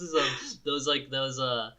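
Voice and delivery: normal talking voice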